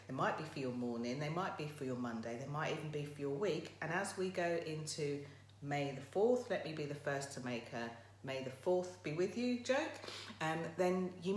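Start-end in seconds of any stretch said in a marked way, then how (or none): none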